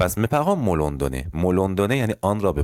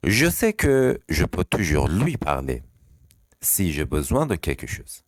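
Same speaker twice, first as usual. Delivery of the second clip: slow reading; much slower than usual. Shown as a demonstration.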